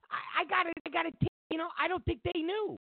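high-pitched insistent protest. Real, not acted. Defending the restaurant against gossip, a high insistent protest. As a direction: insist it, voice jumping up.

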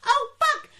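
exasperated. Real, not acted. Exasperated rant about the show.

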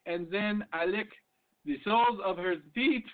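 slow mock French accent. Joke-telling host doing a French accent. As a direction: ham up a Frenchman's voice, slowly. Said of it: "The Frenchman replies".

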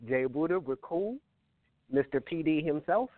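ordinary delivery

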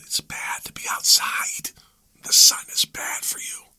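eerie breathy mock whisper of a scold. Mocking the movement telling kids the sun is bad, whispered. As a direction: hiss it like a creepy warning voice. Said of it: "who talks like that?".

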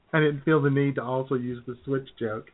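usual speaking voice

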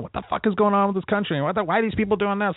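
fed up with the nightly news, mock yelling at the screen. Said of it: "I was yelling at the television".

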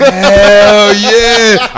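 HELL hollered high, loud and long; exultant yell, fully acted out; ecstatic shout. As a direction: shout it with all your joy. Acting out draft-call joy.

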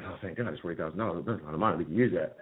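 hushed, quick relief to himself; mock relieved under-breath aside; quiet relieved aside. Relief on seeing the money.

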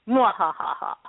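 mock evil cackle; hammed-up villain laugh; mad scientist laugh. Joking that they are evil.

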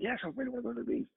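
put-on high, fast, excited kid voice; quick high-pitched eagerness; imitating eager child. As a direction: gush softly like a thrilled little girl. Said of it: "Imagine that we're going to the beach".

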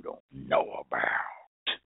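slow, whispered secret in a Southern drawl; conspiratorial whisper. Retold or imitated. Country conjurer ends his pitch in a secretive whisper. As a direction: drop to a hush as if sharing forbidden knowledge. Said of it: "have an accent like that".

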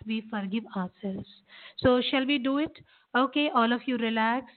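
normal talking voice

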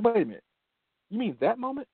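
high-pitched mock disbelief; a lightly voiced coach caught off guard; surprised coach. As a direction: play the coach suddenly remembering, voice jumping up. Coach surprised, recalling the game.

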